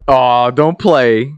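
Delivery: loud, high, drawn-out teasing scolding; mock disbelief. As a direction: draw it out in playful, loud incredulity. Teasing listener who doesn't know Jones.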